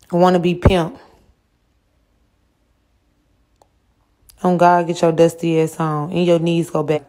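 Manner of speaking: loud mocking roast of a viewer. Real, not acted. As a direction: shout the insults at the commenter. Roasting a chatter loudly.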